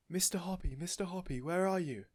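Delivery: high calls from below; excited shouting. A narrator's reading. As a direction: call up to him, thrilled. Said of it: "she was shouting".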